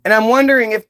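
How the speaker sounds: normal talking voice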